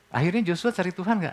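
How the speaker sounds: ordinary talk, nothing marked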